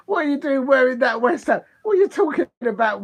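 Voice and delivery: teasing a viewer about the shirt, in mock outrage. Real, not acted. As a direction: tell off the viewer in jest. Scolding chatter over a West Ham shirt.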